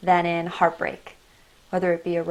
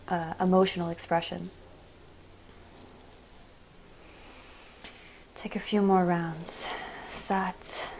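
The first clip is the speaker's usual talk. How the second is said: guided meditation. Acted. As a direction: guide them gently inward, breath by breath. Leads a meditation.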